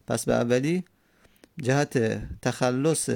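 ordinary talk, nothing marked

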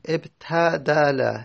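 ابتدل stretched out slowly for the class; slow teaching demo. Shown as a demonstration. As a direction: sound out ابتدل at a crawl. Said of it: "teacher spells out form".

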